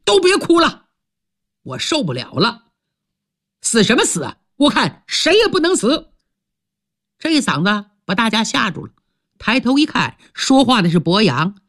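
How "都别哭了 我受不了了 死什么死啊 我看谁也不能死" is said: sudden shout. A narrator's reading. Boyang yells at the weeping crowd to stop, shouting. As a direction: cut through the weeping with a yell. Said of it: "突然有人大喊一声".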